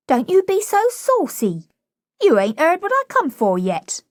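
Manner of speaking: put-on high-pitched, saucy cockney girl; indignant flower girl. Flower girl standing up to Higgins, indignant. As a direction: protest in a high cockney girl's voice.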